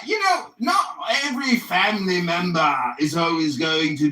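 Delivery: a hammed-up posh English accent, lecturing and snide; mocking English accent. Roasting Tuna Boy on Clubhouse in a mocking English accent. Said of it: "my English accent is so mocking".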